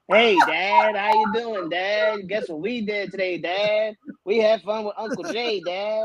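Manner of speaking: high-pitched mock Southern kid drawl; Southern accent. Dad mimicking his girls back from Texas, mock Southern accent. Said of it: "with your goddamn Southern accent".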